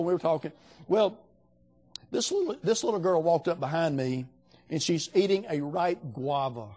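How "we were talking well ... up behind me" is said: usual speaking voice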